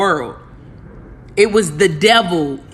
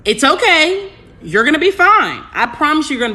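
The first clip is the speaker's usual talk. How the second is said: reassuring coo, pitched up; high reassuring coo; 8 semitones higher than usual. Real, not acted. Soothing the viewers.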